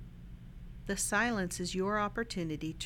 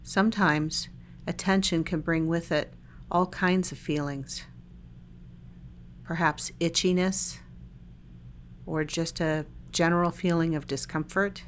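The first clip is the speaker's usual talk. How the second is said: calm body-scan guidance with long silences between phrases; unhurried, even meditation-guide voice; guided meditation; 4 dB louder than usual. Real, not acted. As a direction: lead listeners gently through a meditation, leaving lots of space.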